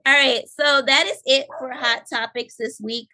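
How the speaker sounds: normal talking voice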